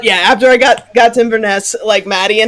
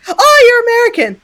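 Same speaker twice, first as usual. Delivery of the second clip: lightly voiced kind woman; 12 semitones higher, 3 dB louder than usual. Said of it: "A nice woman, native to Inverness".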